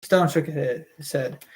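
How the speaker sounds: ordinary delivery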